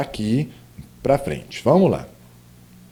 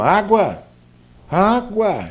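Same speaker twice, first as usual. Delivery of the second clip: "água" said twice with weight on the word, higher the second time; weighted intonation; 6 semitones higher, much slower than usual. Shown as a demonstration. Teacher demonstrates intonation on "água", deliberately stressed and falling. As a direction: lean into the tone of "água", letting it fall. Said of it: "quando eu ponho o peso no tom da palavra".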